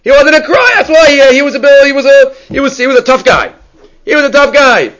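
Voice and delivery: high, hammed-up voice of a naive doubter; mock doubter. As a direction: play the know-it-all who thinks Akiva never cried, pitched high. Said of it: "one might say from that Gemara".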